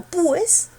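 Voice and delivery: "pues" split as PÚ-es, U drawn out; wrong stress on U. Shown as a demonstration. Teacher showing why it is no hiatus, wrongly stressed U in pues. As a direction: force the U in "pues" into a separate beat. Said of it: "La fuerza no está en la U".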